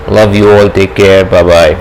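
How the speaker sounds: usual speaking voice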